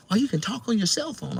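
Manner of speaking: lady on the bus. Retold or imitated. The woman explains Bluetooth to him, in a high imitated voice. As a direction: imitate her patient explaining, high-pitched.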